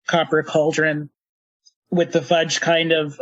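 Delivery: usual speaking voice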